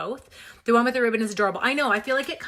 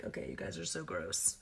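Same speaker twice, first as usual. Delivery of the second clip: low breathy aside, pretending to be grossed out; mock grossed-out whisper; 12 dB quieter than usual. Teacher telling her students they're gross, in a mock whisper. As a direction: whisper it like a fake-disgusted teacher. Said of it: "I'm like".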